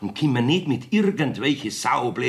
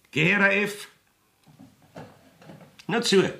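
summoning call; 11 semitones higher, 3 dB louder than usual. Acted. Judge summons the maid before him.

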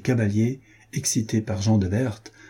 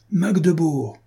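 thundering cry; 7 semitones higher, slower than usual. A narrator's reading. Magnus leaping his horse over the body, battle cry. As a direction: call it out like a rallying cry. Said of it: "cria-t-il d'une voix tonnante".

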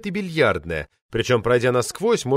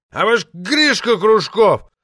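put-on slow, higher, louder drunken roar; 8 semitones higher, 5 dB louder, slower than usual. A narrator's reading. Drunk poet roars at the hall about his translations. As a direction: bellow it out, drunk and wounded. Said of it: "взревел Влад".

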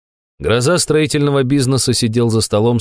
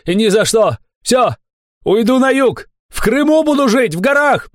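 very high, elated holler; distant shouting. A narrator's reading. Fat man refuses from the forest, shouting. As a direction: yell defiantly from far away.

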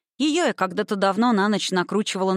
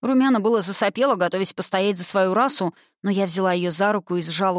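ordinary delivery

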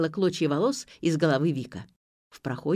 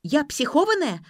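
high loud outraged shout; indignant yell; 8 semitones higher, 4 dB louder than usual. A narrator's reading. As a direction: scream it back, offended. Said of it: "заорала Ксю два".

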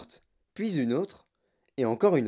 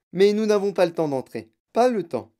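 higher, louder, insistent; hurried refusal; 5 semitones higher, 5 dB louder than usual. A narrator's reading. Wonka refusing to enter, no time, insistent. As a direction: brush it off firmly, pitched up.

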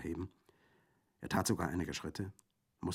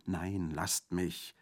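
slow weary refusal; much slower than usual. Acted. Exhausted refusal.

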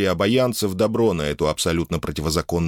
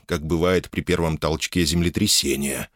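ordinary delivery

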